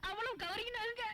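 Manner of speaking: high tearful wail; crying. Acted. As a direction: break into crying on the words. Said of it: "அழாதம்மா".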